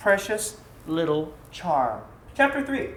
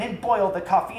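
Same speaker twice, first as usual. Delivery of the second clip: ordinary delivery, nothing marked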